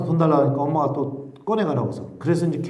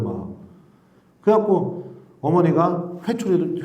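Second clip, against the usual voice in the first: normal talking voice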